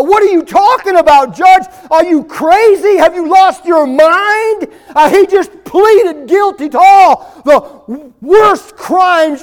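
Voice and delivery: high, fast, indignant crowd cries; outraged protest. Acted.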